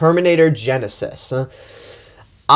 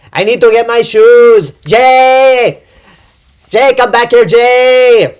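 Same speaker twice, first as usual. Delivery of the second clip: put-on much higher and louder, a frantic shrill accented yell; Sofia Vergara yelling; 9 semitones higher, 8 dB louder, slower than usual. Reviewer mocking the actress shouting for Jay, high loud frantic yell. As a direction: screech after Jay like a panicked diva hunting her shoes. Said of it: "Sofia Vergara going".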